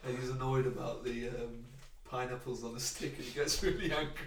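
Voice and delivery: ordinary delivery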